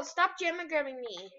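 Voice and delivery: ordinary talk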